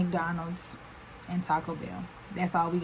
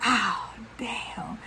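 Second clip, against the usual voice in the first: whispered oh damn; 5 dB quieter than usual. Retold or imitated. Caught by the RA.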